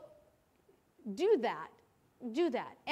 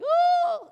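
loud, very high drawn-out "ooh"; a high hoot of mock overwhelm; 11 dB louder than usual. New worker handed a big manual, overwhelmed high "ooh". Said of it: "overwhelmed already".

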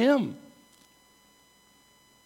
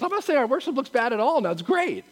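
ordinary delivery

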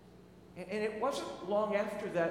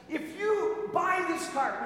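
high, slow hammed-up commercial voice; mock TV ad announcer; ad pitch; 7 semitones higher, 4 dB louder, much slower than usual. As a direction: sell it like a car ad. Mimicking TV ads.

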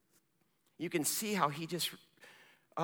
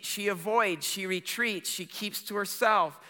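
normal talking voice

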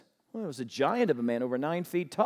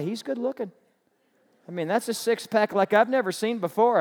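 normal talking voice

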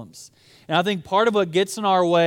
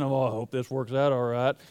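put-on low, softer North Texas accent; 4 semitones lower, 4 dB quieter than usual. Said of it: "kind of North Texas accent".

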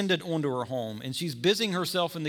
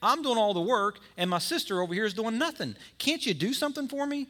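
frustrated Martha; 6 semitones higher than usual. Acted. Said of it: "she turns to Jesus and says".

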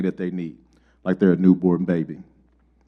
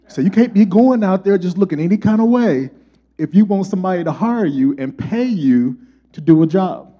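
usual speaking voice

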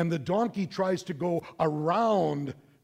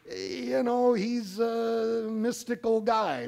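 higher, slow offhand shrug; shrugging servant; 7 semitones higher, slower than usual. Acted. Servants shrugging about Balaam, offhand and slow. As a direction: say it like a casual soldier. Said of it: "maybe they just figured".

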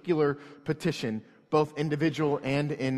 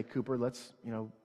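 soft, calm parental voice; gentle tone; 4 semitones lower, 8 dB quieter than usual. Retold or imitated. Father calming his angry son, soft and gentle. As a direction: hush it down, gentle with a child. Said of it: "I respond gently to him".

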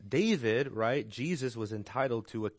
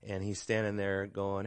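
normal talking voice

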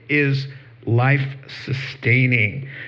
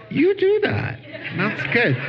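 amused encouragement; 12 semitones higher than usual. Retold or imitated. Amused reply to eager Mike.

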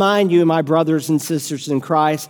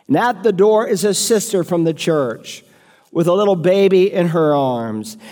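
ordinary talk

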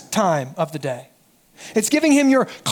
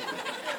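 a sharp, high gasp of mock outrage; mock shocked gasp; 17 dB quieter than usual. Disciples gasping at the wasted perfume, mock shocked. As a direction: gasp as if you can't believe the waste.

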